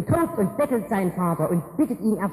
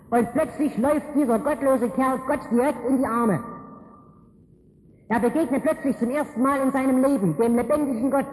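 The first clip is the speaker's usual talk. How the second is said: raised, urgent preacher's voice; fervent preaching; 4 semitones higher than usual. Acted. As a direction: drive home the encounter with God. Preaching climax on meeting God. (eindringliche Predigt)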